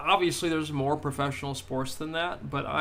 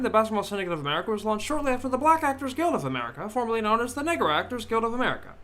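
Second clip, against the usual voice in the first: higher, louder 1930s radio announcer; transatlantic radio announcer; 6 semitones higher, 3 dB louder than usual. Acted. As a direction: announce it like an old-time newsreel. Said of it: "I gotta do the whole nineteen thirties, uh, transatlantic radio announcer voice".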